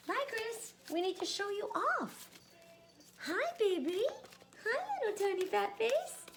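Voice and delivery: very high cooing pet voice; high sing-song call to a pet. Real, not acted. Showing off cat on camera.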